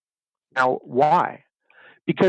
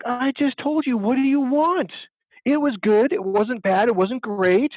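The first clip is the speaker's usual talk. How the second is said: higher-pitched, exasperated protest; exasperated husband; 9 semitones higher, slower than usual. Retold or imitated. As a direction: act out a husband defending his answer. Husband in acted marital quarrel.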